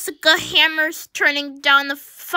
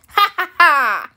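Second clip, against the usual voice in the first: evil cackle. Acted. Plotting to lower fire alarms.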